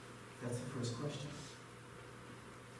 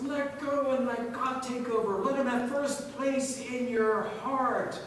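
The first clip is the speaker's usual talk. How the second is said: raised, higher-pitched preacher's voice; impassioned preaching; 9 semitones higher, 6 dB louder than usual. Real, not acted. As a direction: preach it out with rising urgency. Preaches at full force.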